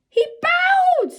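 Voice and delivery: high, loud squeal of surprise; thrilled shock; delighted surprise. A narrator's reading. Girl seeing the beetle bow to her, delighted surprise.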